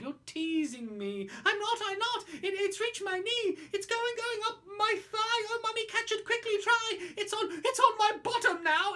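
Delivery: put-on high, fast squealing little child; panicky child. Child begging Mummy to catch the creature, high panic. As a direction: plead with Mummy as it climbs.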